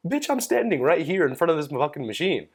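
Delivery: high-pitched retold indignation; indignant retort. Indignant retort to careless joke, retold.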